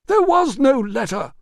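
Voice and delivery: loud, very high shout; furious denial. Acted. Manager shouts down Russo's evidence, loud and high. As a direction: shout the lie down.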